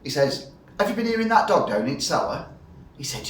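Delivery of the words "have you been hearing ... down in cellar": barman voice. Retold or imitated. Barman voiced in pub joke.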